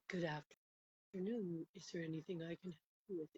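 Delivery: put-on hushed, gentle welcome from an old healer. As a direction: greet her softly and kindly, like a calm elder. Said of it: "In this very gentle voice".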